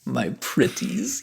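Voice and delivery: slow, crooning hive queen; swarm queen. Acted. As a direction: coo to your brood, drawn out. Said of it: "Resident, uh, Brood Master".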